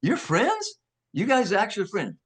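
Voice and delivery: high-pitched disbelieving question; incredulous, pitched well up; retold shock. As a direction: imitate stunned executives, voice shooting up. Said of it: "They were in shock".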